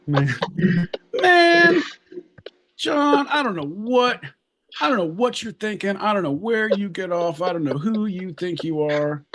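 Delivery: high exasperated mock scolding; mock exasperation. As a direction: playfully scold your friend. Mock exasperated rant at John.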